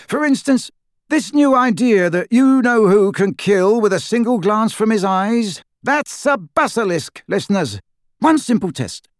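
high, animated broadcaster patter; high-pitched, buoyant comic commentary; lively radio joker. Acted. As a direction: play up a cheeky pirate-radio host, pitched high.

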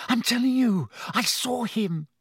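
high-pitched, urgent protest; insist, with alarm in the voice; agitated insistence. A narrator's reading. Ron protesting to doubting roommates, agitated. Boy insists he is not dreaming.

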